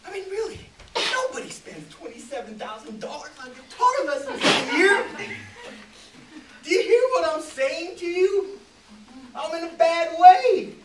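loud, high, desperate rant; exasperated desperation. Acted. Producer begging an old flame to listen, desperate. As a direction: plead at the top of your voice.

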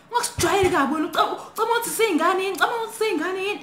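fast, high-pitched imitated scolding; mock angry threat. Intruder berating girl who has no phone, mock anger.

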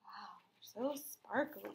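hushed, slow gasp of wonder; awed whisper. Real, not acted. Teacher whispering to the children over the sparkly picture. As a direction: breathe it softly in awe.

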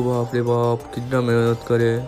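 ordinary talk

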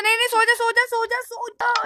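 high, loud frantic urging; urgent shouting. Real, not acted. Gamer begging his character to sleep, frantic. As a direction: shout at the game to hurry up and sleep.

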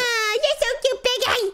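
put-on very high baby-talk squeal to the pig; cooing at pet. Chief greets his pet pig in high cooing baby talk. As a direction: gush over the pig like a doting owner.

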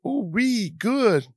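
high squealing ooh wee; a kid thrilled by a cookie; mock childish glee; delighted.

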